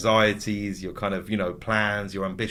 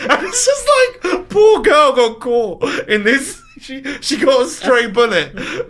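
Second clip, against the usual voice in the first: high, loud words squeezed out through laughter; laughing hard; 13 semitones higher, 6 dB louder, slower than usual. Real, not acted. Host in fits of laughter over the poor girl. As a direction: crack up while telling it.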